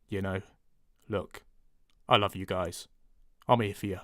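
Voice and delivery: sincere and low; low, warm voice. Shown as a demonstration. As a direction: speak low, soft and a bit warmer. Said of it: "gonna speak a bit low".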